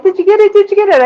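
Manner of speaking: high, excited imitation of onlookers; eager crowd. People in full hallway asking about clemency, mock eager. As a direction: pitch way up, pressing for the news.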